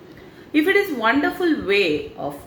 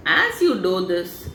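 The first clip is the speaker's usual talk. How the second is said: ordinary talk, nothing marked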